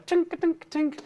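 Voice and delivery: high, light tinking noises; imitated metal clinks. Artie drops the grail, high imitated clinks.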